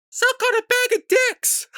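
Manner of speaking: sudden very high-pitched squeal; mock outburst. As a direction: imitate an online gamer exploding in a squealed insult. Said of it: "All of a sudden, you just hear this".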